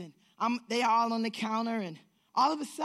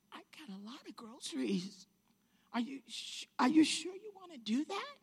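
hesitant disbelief; 5 semitones higher, 3 dB quieter than usual. Retold or imitated. Hesitant about stranger's generous offer.

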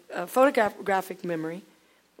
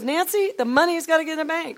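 a high, loud insistent husband; husband's voice; 9 semitones higher, 9 dB louder than usual. Retold or imitated. Husband repeating his money rule to his wife, loud and high. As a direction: press the point, higher and louder. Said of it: "And he goes".

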